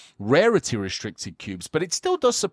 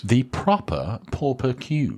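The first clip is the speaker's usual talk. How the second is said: slow posh British "proper Pauper Cube"; a host asks for the British accent, so say it slowly in British; slower than usual. Shown as a demonstration. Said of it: "say proper with your, uh, lovely accent".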